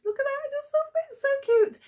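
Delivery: squealing with delight over a doll; high, fast, gushing cooing. Real, not acted.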